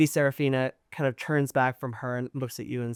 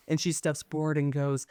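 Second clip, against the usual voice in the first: normal talking voice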